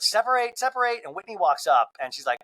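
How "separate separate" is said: higher, urgent repeated call; Angie pulling apart. Retold or imitated. Angie separates the fighters, higher and urgent. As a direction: pull them apart with your voice. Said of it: "And Angie's like".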